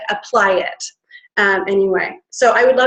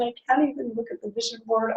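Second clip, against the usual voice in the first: much softer, fast guilty mumble; 11 dB quieter, faster than usual. Retold or imitated. Speaker voicing her own guilt about goals. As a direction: mutter it ashamed. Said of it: "because I'm a terrible person".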